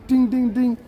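high-pitched ding sounds; imitated bell dings. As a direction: mimic a little bell, ringing high. Imitates intro's tuk-tuk bell.

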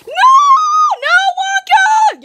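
slow, high, loud pleading cry; panicked plea. Pretend. Toy figure begging Wonka not to push him, panicked cry. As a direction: beg not to be pushed in.

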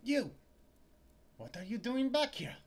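high, loud startled female clerk; a clerk jolted to see him back; shop clerk voice. Acted. Clerk snapping at Larry, high and loud.

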